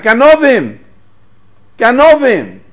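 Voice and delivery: high, loud cry of thieves; retold scream. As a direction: yell it like a robbed market girl. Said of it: "She screams out".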